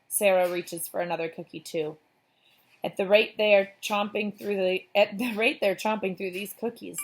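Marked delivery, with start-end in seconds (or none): none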